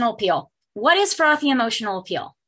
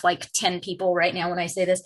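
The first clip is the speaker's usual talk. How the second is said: usual speaking voice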